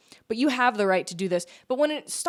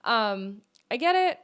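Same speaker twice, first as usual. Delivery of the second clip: sarcastic okay; 7 semitones higher, slower than usual. Retold or imitated. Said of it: "And I was like".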